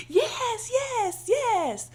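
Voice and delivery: high, excited repeated yes; mock enthusiastic friends agreeing; friends' eager yeses. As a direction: cheer it like delighted friends.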